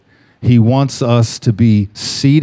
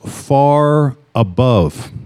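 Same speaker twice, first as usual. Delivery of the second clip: far above drawn out very slow, each word weighed; stress on far above; much slower than usual. Shown as a demonstration. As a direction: lean hard on far above and stretch it slow. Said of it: "I wanna emphasize the words".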